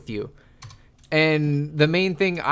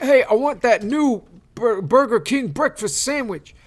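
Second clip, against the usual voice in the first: mock customer voice; 6 semitones higher than usual. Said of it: "He's like".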